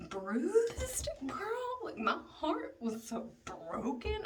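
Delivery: high breathy whine on bruised; hammed-up squeak of heartbreak; dramatic. Telling viewers about the heartbreak, high hammed-up whine. Dramatic self-pity aside.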